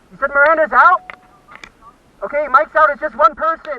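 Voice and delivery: loud, high, rushed team call; urgent excited callout. Real, not acted. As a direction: keep the adrenaline up, shouting. Tense count of remaining enemies.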